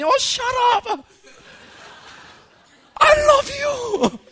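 high, slow, loving exclamation; God's fond reply. Acted. God answering a doubting believer, high, slow and loving. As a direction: brush off the question with affection, slowly. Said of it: "when we come to God".

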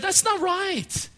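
high-pitched, pained objection; re-enacted indignant plea; inner protest. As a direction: protest it high, drawing the words out. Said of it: "part of me that said".

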